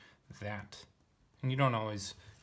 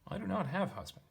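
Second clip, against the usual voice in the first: higher, lightly acted woman's reply; Samaritan woman voiced; 9 semitones higher than usual. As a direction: lift the voice into the woman's line. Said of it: "Woman responded and said".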